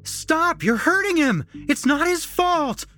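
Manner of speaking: very high, loud desperate scream. A narrator's reading. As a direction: scream to stop them hurting him. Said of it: "A familiar voice came screaming down the hallway".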